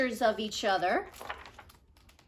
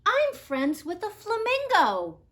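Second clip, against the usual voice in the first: excited Nerdy Birdie; 9 semitones higher, 4 dB louder than usual. Acted. Birdie voiced bragging to friend.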